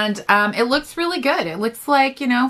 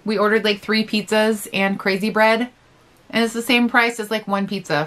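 chewing while talking; talking between bites; mouth full. Real, not acted. Seen 4: Bites pizza before and during; lips pressed, chewing between words.

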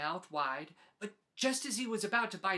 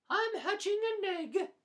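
much higher and louder honking goose; a proud, loud goose; Goose's voice; 13 semitones higher, 6 dB louder than usual. Acted. Goose tells Pig about her egg, high and loud.

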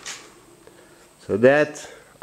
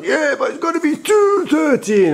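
much higher, excited friend's voice; Yampa's voice; 3 dB louder than usual. Retold or imitated. French friend insisting on the boat's number, high and eager. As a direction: jump in eagerly as the pal. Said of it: "Yampa jumps and says".

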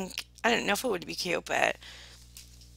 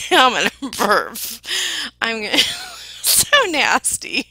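loud, laughing embarrassment; cracking up loudly mid-sentence; real giggling; 2 semitones higher, 10 dB louder than usual. Real, not acted. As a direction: laugh through the words, breaking off. Giggling about needing to burp.

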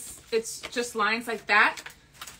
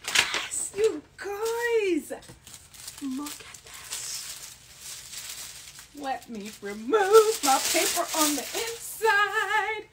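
excited reveal; 8 semitones higher, slower than usual. Real, not acted. Big reveal of the shirt.

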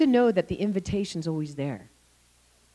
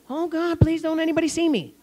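high, mock-frightened plea; fearful pleading; 8 semitones higher than usual. Gideon hiding, praying not to be seen, high anxious plea.

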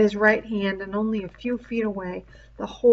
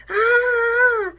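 sharp high yelp of pain, louder; pained cry; 6 dB louder than usual. A narrator's reading. Savage struck by Ralph's spear, pained high cry.

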